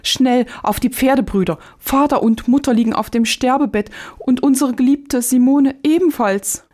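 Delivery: frightened cry. Acted. Joschka seeing his dying parents through the glasses, frightened high cry. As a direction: cry out in sudden fright to the brothers. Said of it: "so schrie er erschrocken auf".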